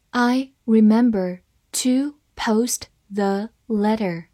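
"I remember to post the letter", slow, word by word; slow read-along. Shown as a demonstration. Teacher modelling the second sentence for learners, slow word-by-word reading. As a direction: pause between words so learners can follow. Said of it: "好，慢慢来读".

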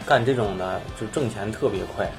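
normal talking voice

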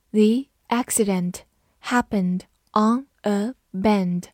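slow, words carefully separated; slow read-through. Shown as a demonstration. Teacher models the bend example for students, slow read-through. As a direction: read it slowly for learners to copy. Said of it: "好，慢读一遍".

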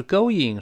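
"going" with no glide between go and ing; wrong reading. Shown as a demonstration. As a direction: say "going" as plain go plus ing. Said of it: "老师说过不要读going".

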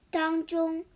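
ordinary delivery, nothing marked

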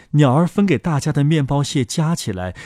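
usual speaking voice

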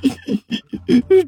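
high whimpering sob that rises; rising sob. A narrator's reading. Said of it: "一滴泪水".

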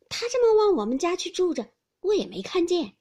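put-on high, loud boyish protest; surprised exclamation. A narrator's reading. Boy answers grandmother about the cousin's trinket, surprised exclamation. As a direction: exclaim that you had no idea. Baoyu exclaims he never noticed.

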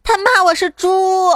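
put-on high, drawn-out child whine; tattling little boy. Pupil reports classmate to teacher, tattling child voice. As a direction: tell on someone in a kiddie voice. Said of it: "小明同学向老师告状".

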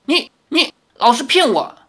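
high, stammered accusation; hurt indignation, pitched well up; trembling anger. A narrator's reading. Girl accusing Li of deceit, indignant and stammering. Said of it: "少女气得发抖".

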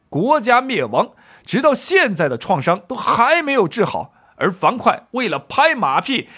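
high, forceful indignant argument; 季布怒斥. Acted. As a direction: press the rebuke, rising to a loud challenge. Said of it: "季布接着说道". (激昂质问，高声驳斥)

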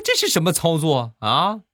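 can't believe what's happening; baffled. Retold or imitated. Husband baffled by wife's attack. (一脸懵的惊讶质问)

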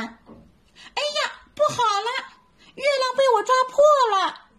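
high, fast panicked little monkey wail; frightened cry. Acted. Little monkey breaks the moon's reflection, high panicked wail. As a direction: wail in fright, rushing the words. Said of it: "小猴子吓得喊了起来".

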